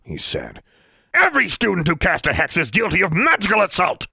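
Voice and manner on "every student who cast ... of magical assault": much higher, louder threat; furious official. Acted. Inspector threatens to charge the students, furious and loud. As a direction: threaten them in a rage. Brown accuses.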